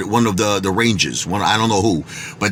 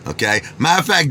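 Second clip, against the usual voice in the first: normal talking voice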